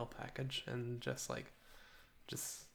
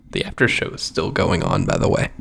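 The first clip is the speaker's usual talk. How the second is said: blown-out distorted mic; loud and crackling through the mic; fuzz distortion; 6 semitones lower, 13 dB louder than usual. Real, not acted. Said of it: "You sound so distorted".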